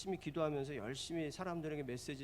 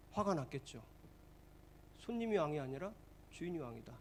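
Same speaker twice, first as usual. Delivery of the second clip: ordinary talk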